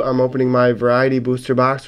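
ordinary talk, nothing marked

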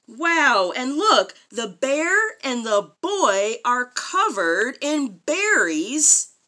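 ordinary delivery

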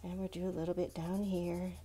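ordinary talk, nothing marked

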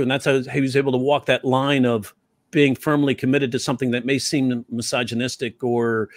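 usual speaking voice

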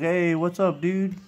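high, breezy bro greeting; casual dude greeting. Retold or imitated. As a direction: toss it off like a laid-back buddy saying hi. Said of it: "he's like".

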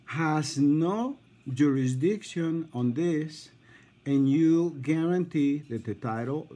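careful, halting, spaced-out delivery; deliberately slow. Real, not acted. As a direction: dictate it piece by piece. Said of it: "I'm gonna go slow".

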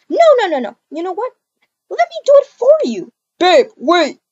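high, loud angry shout; furious refusal. Acted. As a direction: shut him down hard.

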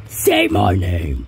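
very low slow demand; deep voice. Acted. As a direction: growl the line slowly.

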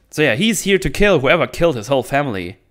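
ordinary delivery

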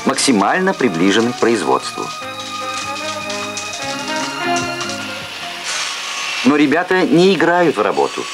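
Soviet-era TV announcer. Real, not acted. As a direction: archival broadcast sound. Archival Soviet TV report.

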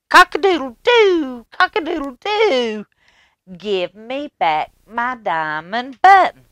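very high, loud crowing cock-a-doodle-doo; shrieking rooster demanding his button back. Acted. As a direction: crow it out like a bold rooster. Rooster crows at king.

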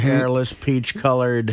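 low, drawn-out teasing; teasing echo. Retold or imitated. Co-host needles Richard about the voicemail, teasing. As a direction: echo the phrase slowly and low.

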